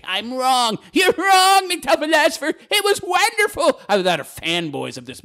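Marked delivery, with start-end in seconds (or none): 0.0-3.8 hammed-up high-pitched protest; mock outraged leftists. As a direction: squeal it like indignant fans. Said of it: "all the leftists are gonna come out of the woodwork to say".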